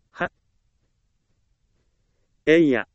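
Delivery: short sharp kiai shout; martial arts kiai. Shown as a demonstration. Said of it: "気合の声が印象的です".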